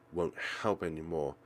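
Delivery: usual speaking voice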